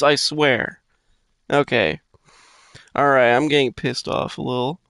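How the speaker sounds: ordinary talk, nothing marked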